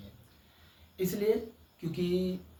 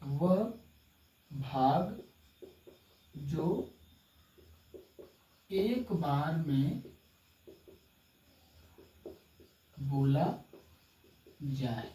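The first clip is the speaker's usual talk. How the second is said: slow dictation; 2 semitones lower, 3 dB quieter, much slower than usual. Shown as a demonstration. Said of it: "teacher dictating a definition".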